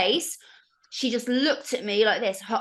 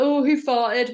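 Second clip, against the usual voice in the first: higher, louder jokey call-out; mock outcry; 5 semitones higher, 7 dB louder than usual. Guest teasing the circle about the smell, jokey outcry. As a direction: call it out to the whole group. Acted heckle in retold story.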